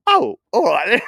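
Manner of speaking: high, loud mock-surprised agreement; imitated reply. Imitating her reaction to the glamping plan, mock-surprised.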